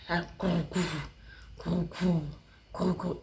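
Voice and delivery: soft low buzz imitating a vibrating phone; phone buzzing sound. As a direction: hum it like a phone on silent.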